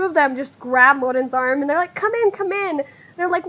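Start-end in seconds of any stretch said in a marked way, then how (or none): 1.9-2.8 put-on high, eager girlish urging; excited welcome. Girls grabbing the guest's arm, excited welcome. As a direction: usher them in with pitched-up excitement. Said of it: "a bunch of excited squealing".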